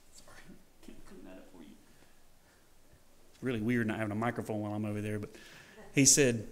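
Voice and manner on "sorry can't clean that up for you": very soft, low mumbled apology; barely audible, timid and apologetic; hushed mock-meek sorry. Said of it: "didn't go up to him and go".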